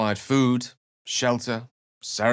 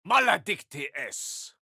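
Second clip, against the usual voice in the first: spell incantation; 9 semitones higher than usual. Acted. Latin spell cast in game.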